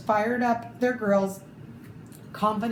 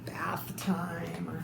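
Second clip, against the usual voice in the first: lower, soft whispered dread; 6 semitones lower, 9 dB quieter than usual. Acted. Reader voicing the dog's dread of the bath, whispered. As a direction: breathe it out in horror.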